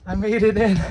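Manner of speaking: high, loud excited yell; shouting over wind. Real, not acted. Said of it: "it's so windy up here".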